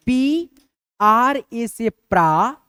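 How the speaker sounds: very slow, stretched letters; slow dictation. Shown as a demonstration. Teacher slowly dictating a spelling to students. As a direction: draw each letter out for the class. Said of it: "इसका हम लोग कैसे लिखेंगे".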